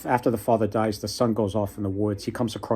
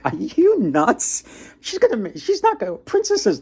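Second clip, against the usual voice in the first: incredulous woodsman; 10 semitones higher, 3 dB louder than usual. Retold or imitated. Woodsman scoffing at the fox's plan, high and incredulous. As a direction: scoff in a high voice, stretching "Are you nuts". Woodsman voiced in folk tale.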